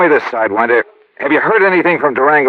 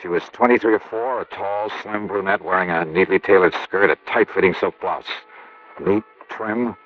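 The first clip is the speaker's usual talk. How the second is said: softer, low-key detective narration; hard-boiled narration; 3 semitones lower, 6 dB quieter than usual. A narrator's reading. Detective narrating the scene to listeners. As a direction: tell it low and close to the mike.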